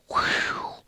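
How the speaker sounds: long, airy whistle-like exhale; sharp breathy whew of disbelief. Real, not acted. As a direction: blow out the breath at a hard choice. Reacting to a tough matchup.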